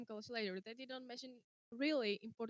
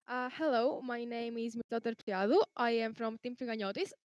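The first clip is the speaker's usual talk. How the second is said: louder, projected self-introduction; speaking louder; 4 dB louder than usual. Real, not acted. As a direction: raise your voice so the listener can hear. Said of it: "can you speak louder".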